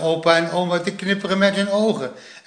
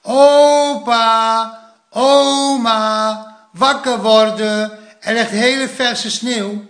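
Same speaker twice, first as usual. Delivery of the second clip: loud, high drawn-out shout; very loud calling; 7 semitones higher, 7 dB louder, much slower than usual. A narrator's reading. Plop waking grandpa and grandma in bed, very loud calling. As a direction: call out loudly to wake the house. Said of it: "riep Plop heel hard".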